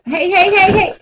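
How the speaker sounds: loud high hey, hey to stop the cat; calling out. Real, not acted. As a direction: call sharply across the room.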